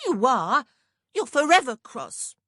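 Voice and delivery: high and loud, a pert little-sister voice; Mercy teasing her cross sister, loud and high; accusing younger sister. A narrator's reading. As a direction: fling it at her like a cheeky youngest.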